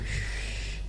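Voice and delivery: a soft unvoiced shush. Rabbi shushing the student, a soft shh. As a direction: hush him with a long shh. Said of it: "בשקט".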